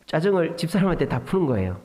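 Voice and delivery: ordinary talk, nothing marked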